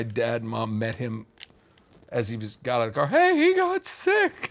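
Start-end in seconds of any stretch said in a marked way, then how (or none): 3.1-4.5 high, slow fake-innocent excuse; teen lying to parents in the driveway; sheepish lie. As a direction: drag out the excuse like a caught teen.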